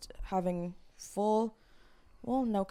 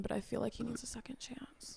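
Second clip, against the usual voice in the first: whispering; 3 semitones higher, 10 dB quieter than usual. Acted. Soft aside about nervous guy.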